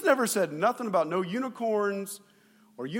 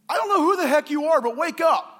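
impatient plea; 6 semitones higher, 6 dB louder than usual. Retold or imitated. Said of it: "it says".

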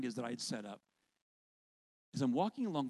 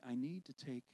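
lightly voiced inner voice, softer; quiet nudging voice; 6 dB quieter than usual. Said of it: "this voice is telling me".

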